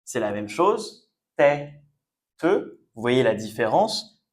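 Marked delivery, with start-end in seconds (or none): 1.3-2.8 TÊTE as "tè", pause, "te"; split into syllables. Shown as a demonstration. Teacher asking learners to see the difference, "tête" syllable by syllable. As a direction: break "tête" to show both vowels. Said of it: "Deux E qui se prononcent différemment".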